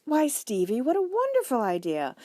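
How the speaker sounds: high, loud delighted mother; excited. A narrator's reading. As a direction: gush over the child's idea. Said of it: "said Missus Franklin excitedly".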